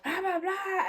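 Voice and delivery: high mock-whiny accusation; retold accusation. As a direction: imitate the raving friend in high pitch. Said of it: "So she's like".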